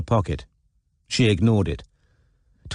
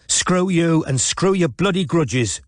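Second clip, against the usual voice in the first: shouted; 7 semitones higher, slower than usual. A narrator's reading. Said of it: "shouted Robin".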